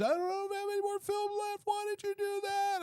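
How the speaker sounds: mock high shrill woman voice; furious woman. Stranger scolding him about wasted film, high mock fury.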